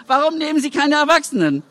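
high, challenging question; desperate parent. A narrator's reading. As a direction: demand an answer in despair.

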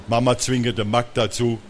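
plain reading voice

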